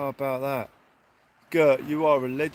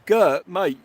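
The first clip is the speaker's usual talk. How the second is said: excited call-out; 4 semitones higher, 8 dB louder than usual. Real, not acted. Overwhelmed by a big donation.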